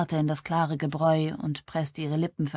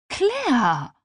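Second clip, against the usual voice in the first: high, loud, falling cry of the name; entsetzter Ausruf; 8 semitones higher, 5 dB louder than usual. Acted. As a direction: call out the friend's name in shock. Freundin reagiert entsetzt auf Geständnis. (entsetzt ausgerufen)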